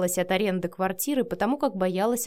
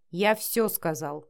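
plain reading voice

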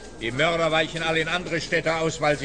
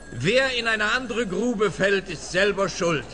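proud recitation; 5 semitones higher, slower than usual. Acted. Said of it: "Ich habe noch eine gedichtet".

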